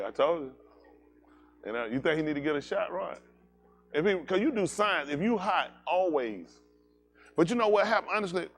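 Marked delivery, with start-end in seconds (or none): none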